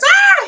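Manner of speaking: high, loud startled yelp imitating a kid; mock frightened scream. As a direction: shriek like a kid freaking out. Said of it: "saw Chloe and goes".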